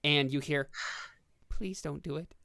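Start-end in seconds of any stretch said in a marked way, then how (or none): 1.5-2.3 slightly hushed plea of a talking arrow; lightly voiced little pleading arrow; pleading arrow's whisper. Game master voices an arrow begging not to be fired, lightly hushed plea. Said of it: "as if you hear a whisper".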